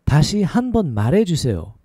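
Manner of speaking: syllables joined into one flowing phrase. Shown as a demonstration. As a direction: run it together at conversational speed. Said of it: "A little faster".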